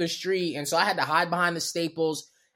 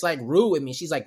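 normal talking voice

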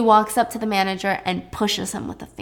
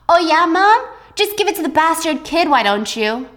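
higher, louder bitter sarcasm; jealous daughter mocking mom; sarcastic snap; 7 semitones higher, 3 dB louder than usual. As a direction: sneer it at your mother.

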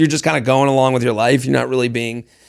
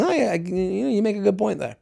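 apologetic; 4 semitones higher, 7 dB quieter than usual. Retold or imitated. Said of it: "almost apologetic".

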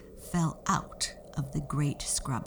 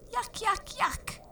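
put-on squeaky, much higher little girl voice; tiny girl crying out. Tottie dropped in porridge, squeaky disgusted cry. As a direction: squeal it like the smallest child in the bowl. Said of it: "cried Tottie".

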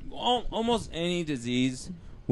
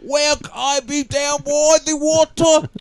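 loud, slow, much higher mock Australian voice; bad Australian accent; 10 semitones higher, 9 dB louder, much slower than usual. Friend performs his bad Australian surfer voice. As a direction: ham up a terrible Australian surfer. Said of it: "Australian accent".

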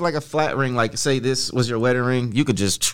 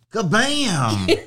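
punchy excited exclamation; playful kabam; 9 semitones higher than usual. Acted. Man announcing fiancée's ring, playful excited burst. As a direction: slam it down like a reveal.

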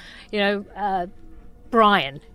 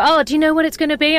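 higher, louder, quick quoted question; a touch of eager curiosity, imitated; lightly mimicked asker; 7 semitones higher, 5 dB louder, faster than usual. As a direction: put on someone asking, pitched up and quick. Quoting a question put to her.

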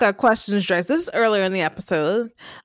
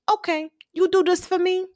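Blanca voice; 8 semitones higher than usual. Retold or imitated. Blanca making a deal, retold.

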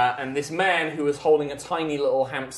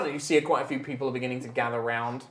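usual speaking voice

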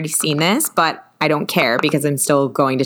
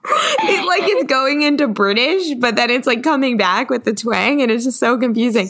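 high, giggly explanation; squeaky from laughing, gradually settling; laughing embarrassment; 7 semitones higher than usual. Real, not acted. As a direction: talk through the tail of a laughing fit. Explaining failed accent while laughing.

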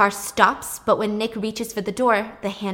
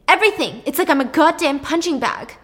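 desperate shout; 6 semitones higher, 7 dB louder than usual. Retold or imitated. Brother's desperate outburst.

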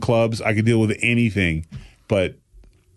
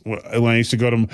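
normal talking voice

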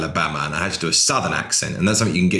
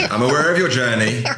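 higher, laughing repeat; amused; 5 semitones higher than usual. Real, not acted. Guest teasing the host about a shared weight journey, amused. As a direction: say it through a laugh.

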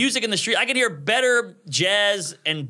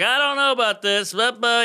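higher, nagging whine; whiny friend; 8 semitones higher than usual. Retold or imitated. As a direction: nag like a wary bridesmaid. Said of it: "one of them's like".

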